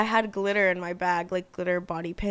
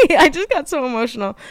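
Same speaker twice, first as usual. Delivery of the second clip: louder, higher, breaking out of the emotion; sudden loud, flustered lift; embarrassed laughing apology; 5 semitones higher, 8 dB louder than usual. Real, not acted. Embarrassed laugh after getting emotional.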